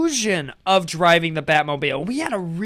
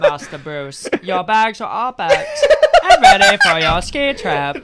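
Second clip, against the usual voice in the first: a bad, hammed-up British butler accent, slow and a bit louder; 2 semitones higher, 8 dB louder, slower than usual. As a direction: play a stiff British butler, badly. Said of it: "That's your British accent?".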